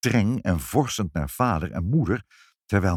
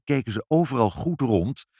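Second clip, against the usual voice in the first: ordinary delivery, nothing marked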